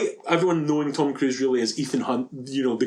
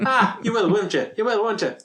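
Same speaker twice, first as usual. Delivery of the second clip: higher-pitched stage Irish wheedling; mock Irish accent; 7 semitones higher than usual. Co-host playing a pushy Irish vampire, mock Irish wheedling. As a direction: coax in a cod Irish lilt. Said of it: "thinks they're back and far and away".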